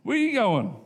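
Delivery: wife yelling after her fisherman husband, indignant; wife's shout. Retold or imitated. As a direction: call after him like an angry wife. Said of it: "Can you imagine the wives' response".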